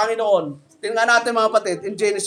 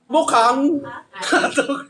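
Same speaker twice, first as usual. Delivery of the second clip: slow punchline; much slower than usual. Real, not acted. Punchline of a joke.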